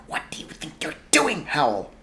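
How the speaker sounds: fierce hushed demand; fierce whisper. Acted. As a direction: hiss it so others won't hear. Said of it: "overhear Missus Pendragon demanding".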